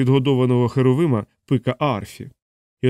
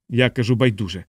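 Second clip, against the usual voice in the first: plain reading voice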